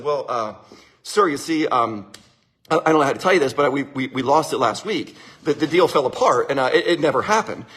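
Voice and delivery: low, halting bad-news voice; hesitant confession. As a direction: stall and stammer before admitting it to the boss. Sales manager confessing to angry boss.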